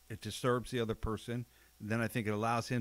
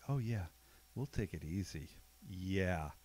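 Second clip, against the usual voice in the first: soft, low, drawn-out assent; knowing fake agreement; 3 semitones lower, 7 dB quieter, slower than usual. Wary veteran humouring a sore partner, soft and low. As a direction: agree quietly while staying on guard. Feigned agreement, no longer falls for it.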